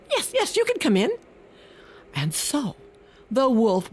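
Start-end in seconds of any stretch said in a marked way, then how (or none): 0.1-1.1 much higher, quick squeaky yes; mouse. Acted. Mouse welcomes the wolf, high and quick. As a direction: chirp the welcome fast. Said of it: "mouse answers wolf".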